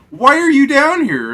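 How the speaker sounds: high, loud, startled question; shocked to find them down there; alarmed question. Retold or imitated. Alarmed question in retold dream.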